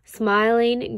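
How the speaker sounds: ordinary delivery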